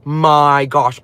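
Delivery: slow, drawn-out mock gasp of alarm; drawn-out retold dismay; retold shock. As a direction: stretch it out as if just realising. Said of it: "and I was like".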